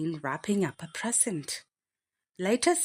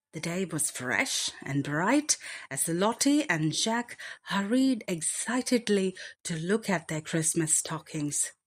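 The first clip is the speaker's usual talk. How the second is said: plain reading voice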